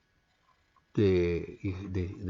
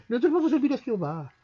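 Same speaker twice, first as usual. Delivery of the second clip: eager crowd voice; 9 semitones higher, faster than usual. Acted. Preacher voices the people.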